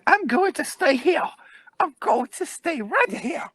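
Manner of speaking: put-on very high, squealing old-woman voice; stubborn ancient woman. Old woman refusing to leave daycare, high stubborn squeal. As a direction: dig in with a drawn-out squeal. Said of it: "this woman's, like, almost, like, a thousand years old".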